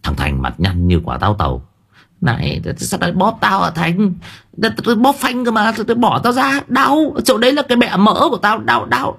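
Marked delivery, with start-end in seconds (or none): 2.2-8.6 much higher, whiny squeal of pain; high panicked yelp of a squeezed rider; Hải squealing in pain. Acted. 8.6-9.2 much higher, repeated yelp of pain; squealing đau đau. Acted.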